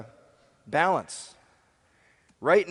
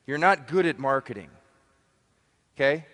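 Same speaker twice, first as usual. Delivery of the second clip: ordinary talk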